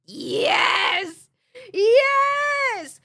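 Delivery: loud, high cry of yes; thrilled shriek of yes; excited yelling. Real, not acted. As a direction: shout it out with glee. Excited cheering at a question.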